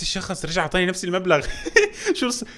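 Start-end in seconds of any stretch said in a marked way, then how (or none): 1.5-2.1 squeaky burst of laughter; high laugh. Real, not acted. Gamer laughing at his lucky streak. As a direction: laugh in disbelief.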